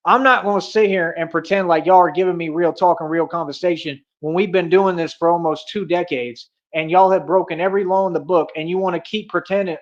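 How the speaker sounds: ordinary delivery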